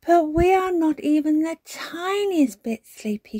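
put-on high, loud little-girl voice; protesting child. A narrator's reading. Peppa telling Granny she is not sleepy, protesting. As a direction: insist stubbornly like a child.

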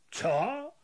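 sharp high bark of a question; menacing what. A narrator's reading. Kapo hearing about the watch, sharp barked question.

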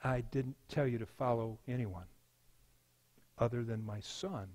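low, soft interruption; voice of God. Acted. God interrupts the sinner's excuses, low and quiet. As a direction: cut in quietly, very low and measured. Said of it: "Lord would just interrupt and say".

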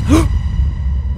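sharp, high gasp; real audible gasp of alarm; startled gasp. Real, not acted. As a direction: suck in air in sudden shock. Startled by what he sees.

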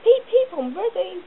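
high, loud eager call like a whistle. A narrator's reading. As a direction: sing it out ready to go. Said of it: "whistled Edward".

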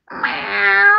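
high, drawn-out meow; cat meow. Acted. Introducing the cat to the children, cat meow. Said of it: "we have the cat".

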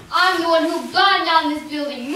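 loud gloating villain. Acted. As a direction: boast it like a storybook villain. Villain reveals herself.

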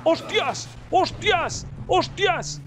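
high startled cries, climbing each time; shocked yelps. Real, not acted. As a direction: yelp in real shock. Horse throws him. (gritos agudos de sorpresa)

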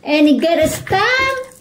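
high cooing mom voice; sweet high fuss over a tiny necklace; cooing over cute toy. Real, not acted. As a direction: coo it up high at the cute little things.

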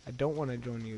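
ordinary talk

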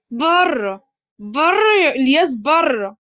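playful, drawn-out order to leave; mock anger. A narrator's reading. Boss throwing his joking friends out, in mock anger. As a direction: pretend to be cross, without shouting. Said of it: "عمر بغضب مصطنع".

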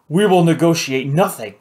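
a loud, contemptuous refusal; spat out. A narrator's reading. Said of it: "Justin spat".

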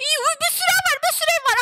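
high squeal of excitement; excited discovery. Real, not acted. Spots many houses. (heyecanla tiz sesle haber verme)

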